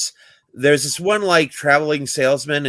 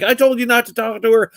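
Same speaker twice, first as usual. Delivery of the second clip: high, raised scolding voice; angry father; 9 semitones higher than usual. Retold or imitated. Father scolds the salesman with a raised, high angry voice. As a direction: scold him like a furious dad.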